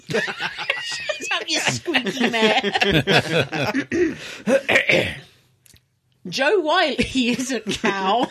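laughing through words; can't stop giggling. Real, not acted. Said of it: "It's gonna be one of those evenings".

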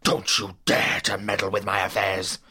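low, menacing warning; dark, angry growl; threatening. Acted. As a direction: warn them off with held-in fury. Villain threatens Holmes.

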